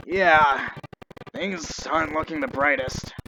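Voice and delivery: usual speaking voice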